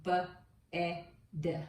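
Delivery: B, e, d said sound by sound; each sound of bed apart; segmented sounds. Shown as a demonstration. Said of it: "Now blend the sounds with me".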